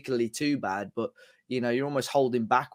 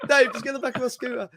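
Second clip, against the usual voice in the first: imitating Matt, much higher; mock high voice; 12 semitones higher than usual. Teasing Matt's scooter offer to Dave, squeaky mock voice. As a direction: pitch it way up as a teasing mimic of Matt. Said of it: "Matt was like".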